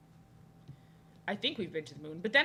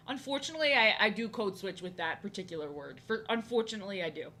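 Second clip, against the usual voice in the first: ordinary delivery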